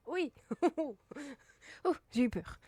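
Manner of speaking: relieved laughing shout. Real, not acted. Soulagement après le combat. (rire de soulagement, plus fort)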